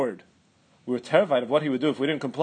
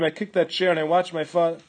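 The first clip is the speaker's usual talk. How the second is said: ordinary delivery, nothing marked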